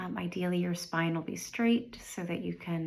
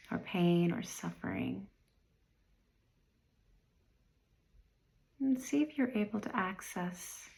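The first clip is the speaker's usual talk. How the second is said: guided meditation. Real, not acted. Meditation guide inviting listeners to review the year, unhurried and calm. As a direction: guide them gently inward, leaving space after each phrase.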